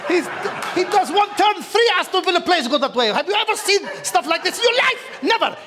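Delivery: put-on playful Arabic accent with wallahi. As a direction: gush about Mahrez in an Arabic accent. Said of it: "I'm gonna keep talking in the Arabic accent".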